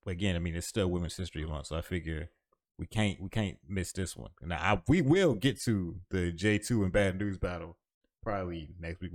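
ordinary delivery

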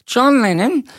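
high nasal Lennon impression; [name] Liverpool accent. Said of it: "and then he was doing".

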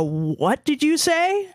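high, drawn-out mock disbelief; baffled reaction. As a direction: imitate a baffled coworker, slow and high. Retold coworkers' baffled reaction.

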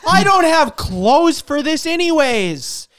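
high, loud, slow whiny complaint; mock entitled rant. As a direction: whine like a spoiled tourist. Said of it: "And he goes".

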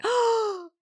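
high, fast, thrilled disbelief; excited gasp. Retold or imitated. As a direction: suddenly feel the baby and can't believe it. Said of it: "I was like".

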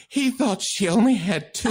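laughing through the words. Retold or imitated. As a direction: giggle out the line while retelling it. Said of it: "she starts laughing again hysterically".

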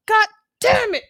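high, loud angry outburst; furious realization. Retold or imitated. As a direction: yell it as you realize you're betrayed. Dre discovering the setup, retold.

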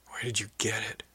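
hushed, breathless whisper. A narrator's reading. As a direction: barely breathe the question in awe. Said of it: "she asked breathlessly".

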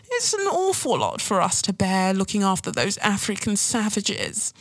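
mock aristocratic Brit, affronted and condescending; posh British accent. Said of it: "I always go for that kind of accent".

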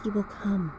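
soft, hushed shadow voices; quiet ominous warning, like shadows; dark whisper behind you. A narrator's reading. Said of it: "the shadows whispered".

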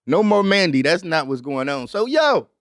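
usual speaking voice

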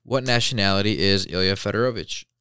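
ordinary talk, nothing marked

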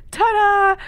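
loud high showman's ta-da; loud cheery ta-da. Retold or imitated. Dad joking about any mishap, loud showman's ta-da. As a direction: present it like a magic trick. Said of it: "He's like".

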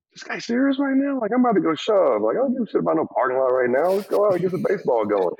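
high incredulous rant about the owner; incredulous self. Retold or imitated. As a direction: pitch way up in disbelief, then push fast. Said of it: "the way he does the impression".

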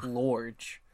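put-on large with an O, upper Midlands accent; drawled Midlands accent vowel. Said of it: "upper Midlands accent".

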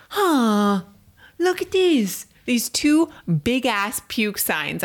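high, mocking impression of the husband; teasing impression of the husband's obliviousness. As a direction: play the clueless husband in a high voice. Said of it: "you're like".

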